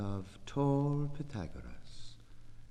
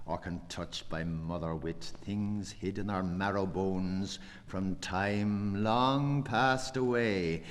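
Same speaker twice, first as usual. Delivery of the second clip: normal talking voice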